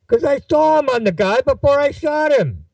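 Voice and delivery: high, strained outburst as Rick; agitated retort. Acted. Shooter defending himself to his doubting friend, high agitated retort. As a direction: push the words out high and defensive.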